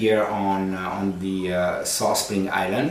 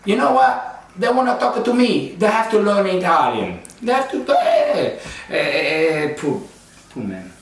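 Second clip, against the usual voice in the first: much higher, outraged Italian dad; hammed-up Italian dad laughing at himself; upset Italian dad; 9 semitones higher than usual. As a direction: rant like a fed-up Italian father. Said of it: "he got upset".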